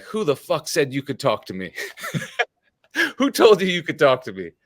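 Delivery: retelling the boss's outburst while cracking up; mock angry boss, laughing. Imitating his boss yelling at him, laughing throughout. Boss angrily confronts teleprompter guy.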